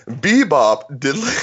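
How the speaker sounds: giggling through the words; trying not to crack up; laughing. Real, not acted. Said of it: "Got the giggles now".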